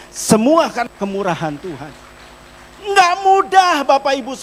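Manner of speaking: loud, rising preacher's call to the congregation; full-force preaching. Acted. Pastor urging the congregation to give, preached loud. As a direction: drive it home like a revival sermon.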